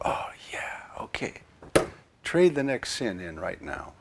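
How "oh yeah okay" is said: breathy little whisper of a listener agreeing; a hushed, shy yes; whispered reply. Pretend. Imagined listener whispers back to the priest, hushed.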